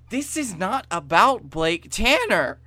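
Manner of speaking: lightly voiced, deliberate insistence; Austin voice. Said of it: "I love your Austin voice".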